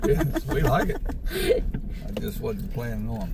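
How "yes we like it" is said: laughing through the words; giggly, high and slow. Real, not acted. Laughing while agreeing.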